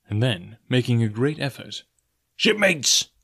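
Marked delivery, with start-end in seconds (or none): 2.3-3.1 rallying cry. Acted. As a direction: call them to order with effort. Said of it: "he cried".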